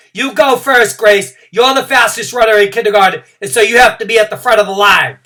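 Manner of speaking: high, loud eager voice; eager urging. Acted. Junie B telling Grace to go first, eager and loud. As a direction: push your friend to the front.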